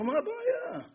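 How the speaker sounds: high, scoffing laugh through the words; mocking laugh. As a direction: scoff at it like it's nothing. Thug mocks the easy job.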